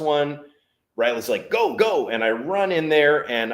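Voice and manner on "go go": high loud go go; urgent shout. Retold or imitated. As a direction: urge him on fast. Cowboy urging him in.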